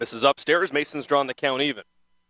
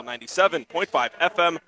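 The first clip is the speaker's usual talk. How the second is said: ordinary delivery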